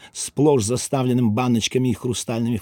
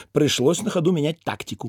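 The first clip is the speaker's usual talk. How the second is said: ordinary delivery, nothing marked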